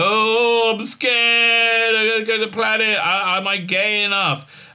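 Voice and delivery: high, wailing mock fear; hammed-up timid whine; mock scared. Reviewer mocking crew heading to the planet, scared.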